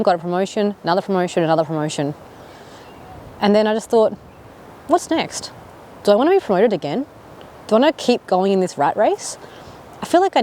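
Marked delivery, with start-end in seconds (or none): none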